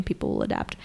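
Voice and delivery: whispered aside. Retold or imitated. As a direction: breathe it without voice. Whispered quote of Polnareff.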